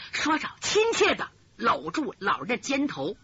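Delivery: usual speaking voice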